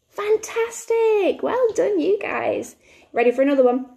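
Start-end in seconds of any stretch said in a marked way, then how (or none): 0.1-2.8 high, drawn-out encouragement; delighted praise. Pretend. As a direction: cheer the little learners on. Teacher praises children's correct answer.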